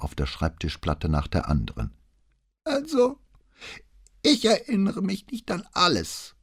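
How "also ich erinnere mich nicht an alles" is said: put-on suddenly high, shrill old man's voice; querulous greybeard act, high and shrill; shrill whining geriatric. A narrator's reading. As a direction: whine like a doddering old man. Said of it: "die plötzlich hoch und schrill klang".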